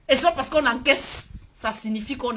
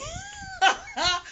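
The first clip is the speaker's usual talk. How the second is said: mock sobbing laugh; 4 dB quieter than usual. Real, not acted. Mock tears turning to laughter.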